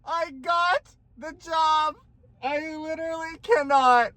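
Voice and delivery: real tearful disbelief; tearful joy. Real, not acted. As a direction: still choked up with happiness. Tearful joy after news.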